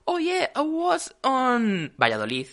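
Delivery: high, loud, drawn-out English sliding into Spanish Valladolid; exaggerated English, then abrupt Spanish accent on Valladolid; switched accent mid-sentence. Podcaster imitating bilinguals switching languages, exaggerated accent switch. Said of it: "de repente dice algo pronunciado en otro idioma".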